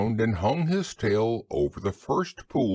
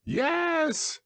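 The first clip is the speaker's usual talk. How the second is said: very high little squeak; squeaky Roo. A narrator's reading. Roo answers Kanga from the pool in a high squeak. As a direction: pipe it out at the top of the voice. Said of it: "squeaked Roo".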